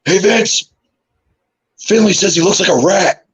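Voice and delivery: high-pitched mock colleague voice; John Laurinaitis. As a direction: call over to the boss in a high impression. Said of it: "John Laurinaitis looks over to Vince".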